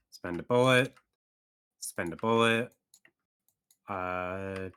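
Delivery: ordinary delivery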